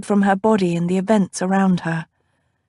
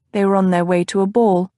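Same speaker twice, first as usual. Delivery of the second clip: ordinary delivery, nothing marked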